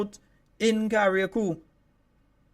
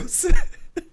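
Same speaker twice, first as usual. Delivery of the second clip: unvoiced burst of laughter; breathy laugh; 12 semitones higher, 9 dB louder than usual. Real, not acted. Laughs while reading numbers.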